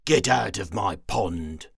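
loud, grumpy order; grumpy shout. Acted. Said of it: "he grumped".

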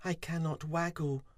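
high, small, sorry voice; high-pitched, woeful little voice; moaning little bee. A narrator's reading. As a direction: moan it as a dejected tiny bee. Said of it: "poor Fuzzy moaned".

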